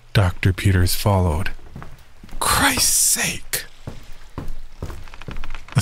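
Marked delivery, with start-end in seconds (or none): 2.4-3.7 whispered exasperated curse. Acted. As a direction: hiss it under breath. Exasperated aside while following.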